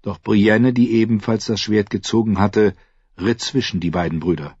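ordinary delivery, nothing marked

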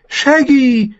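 high, loud joyful call to a dog; a small boy calling out gladly; happy call. A narrator's reading. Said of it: "Rickon rief glücklich".

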